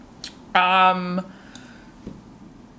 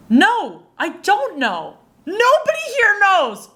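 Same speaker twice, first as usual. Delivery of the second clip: high, incredulous protest; exasperated retort; 10 semitones higher than usual. Acted. Student answering the teacher's snow monkey speech, exasperated. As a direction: rise higher in mock exasperation.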